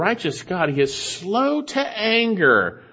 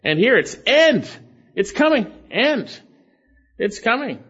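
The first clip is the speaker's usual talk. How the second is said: "End" punched high, then a pause before "It's coming"; short, high emphatic bursts on "End" and "It's coming"; 6 semitones higher than usual. Shown as a demonstration. Preacher shows the congregation the emphatic word order, punching "End". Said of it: "that's an emphatic statement".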